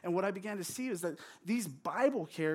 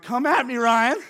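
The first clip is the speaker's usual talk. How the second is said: loud, high mock challenge; hammed-up shouted dare; retold yelling; 5 semitones higher, 12 dB louder than usual. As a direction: yell it like an upset kid picking a fight. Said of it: "I'm just yelling at Ryan".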